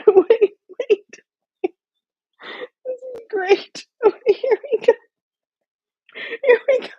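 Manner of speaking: very high, loud words through real laughter; helpless high-pitched giggling speech; laughing to tears. Real, not acted. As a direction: squeak it out while cracking up. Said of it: "I'm really crying".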